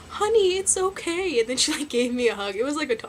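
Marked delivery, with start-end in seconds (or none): 0.1-1.4 higher, slow soothing voice; gentle teacher. Retold or imitated. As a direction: soothe a crying kid, slowly. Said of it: "she was like".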